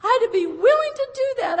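pushing through tears as she confesses to the congregation; tearful. Real, not acted. Preacher telling the congregation of her surrender, laughing through tears.

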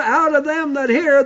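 ordinary talk

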